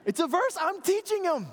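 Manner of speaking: ordinary talk, nothing marked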